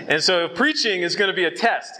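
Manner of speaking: ordinary delivery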